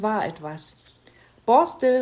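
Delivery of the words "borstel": loud call up the stairs; mother calling her son up to the attic. Acted. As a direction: call the boy's name loudly, falling at the end. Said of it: "rief sie".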